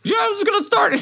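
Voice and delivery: very high, loud character voice; defensive droid navigator. Acted. Mira answering Sybil about scanning, high defensive voice. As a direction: protest in a high-pitched droid voice.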